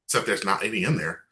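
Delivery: normal talking voice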